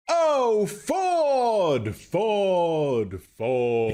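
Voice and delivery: high drawn-out wail sliding down; descending scale. A narrator's reading. Supernatural voice speaking from above their heads, diminishing on a descending scale. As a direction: start high and fade down the scale, each word lengthened. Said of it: "diminishingly and on a descending scale".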